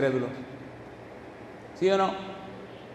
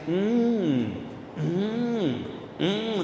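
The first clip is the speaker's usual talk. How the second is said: long, softer "mm" rising and falling; imitated humming moan; 5 dB quieter, much slower than usual. Preacher mimics someone at his campaign, drawn-out moaning hum. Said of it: "había una persona que me decía".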